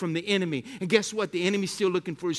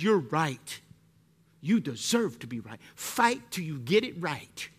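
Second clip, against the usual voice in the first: proud insistence; 5 semitones lower, 4 dB quieter, slower than usual. Retold or imitated. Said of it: "You know what pride says".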